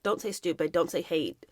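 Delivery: low, soft imitation of a parent; admonishing parent. Parents correcting their child's words, low soft admonishing. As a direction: gently lay down the rule, voice dropped. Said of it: "my parents were always like".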